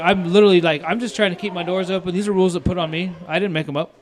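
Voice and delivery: re-enacted frustrated protest; retold pissed-off protest. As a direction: plead your case indignantly, as retold. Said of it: "I'm fucking pissed".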